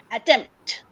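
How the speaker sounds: soft "at", firm TEMPT in "attempt"; stress on second syllable. Shown as a demonstration. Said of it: "At is very soft".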